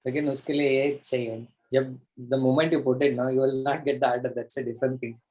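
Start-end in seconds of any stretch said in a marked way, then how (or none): none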